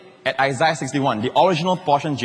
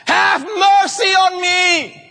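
very high, drawn-out shouted plea; desperate shout; 6 dB louder, much slower than usual. Acted. Blind beggar calls to Jesus passing by, shouting. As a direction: shout it at full voice, stretching the words. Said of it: "Then he shouted".

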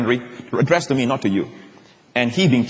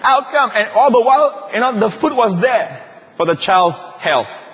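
full-force preaching; 7 semitones higher than usual. Acted. Preacher telling the congregation at full force. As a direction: preach it out to the whole church.

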